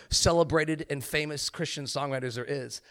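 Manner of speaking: ordinary talk, nothing marked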